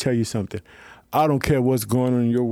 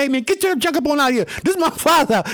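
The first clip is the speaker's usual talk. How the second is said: Jesus chasing them out; 12 semitones higher, faster than usual. Retold or imitated. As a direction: throw them out of the temple. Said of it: "He went up there and said".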